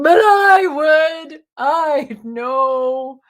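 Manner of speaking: high, loud, slow whine; whiny self. Retold or imitated. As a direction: stretch the whine out like a sulky child.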